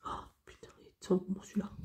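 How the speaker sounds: whispered swear, no voice. Real, not acted. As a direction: hush it like a naughty secret. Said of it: "J'ai dit un gros mot". (juron chuchoté)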